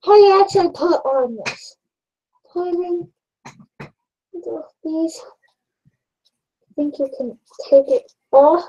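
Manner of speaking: muffled behind respirator; through a face mask. Real, not acted. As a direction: voice dampened by mask. Seen 2: half-face respirator covers nose and mouth throughout labelled words.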